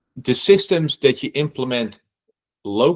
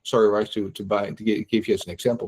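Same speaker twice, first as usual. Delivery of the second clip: ordinary talk, nothing marked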